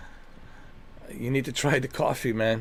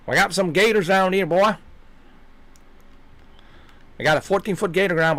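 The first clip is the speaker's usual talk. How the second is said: higher-pitched hammed-up Southern swamp accent; 7 semitones higher than usual. Swamp dweller boasting of gators, thick Southern accent. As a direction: slur it into swamp Southern babble. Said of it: "thick swamp southern accent".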